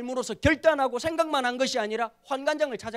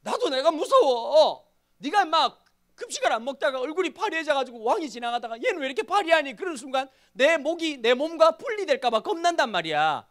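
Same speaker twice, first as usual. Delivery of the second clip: high, loud comic protest of fear; acted fearful pleading; 6 semitones higher, 5 dB louder than usual. Pastor playing the chief official confessing fear to Daniel, mock-frightened. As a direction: plead in exaggerated worry for your own neck. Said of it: "지금 환관장이, 교장이 이렇게 말".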